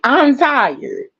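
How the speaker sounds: laughing so as not to cry; tired, near tears. Real, not acted. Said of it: "I have to laugh to keep from crying".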